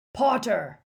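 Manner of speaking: loud sharp call of a name; stern bark. Acted. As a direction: stop him with a bark. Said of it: "Aberforth Dumbledore stood blocking".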